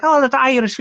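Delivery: high, up-there Irish lilt; light Irish imitation; Irish accent. Shown as a demonstration. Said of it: "it's a little up there".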